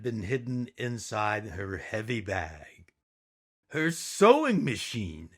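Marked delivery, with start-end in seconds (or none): none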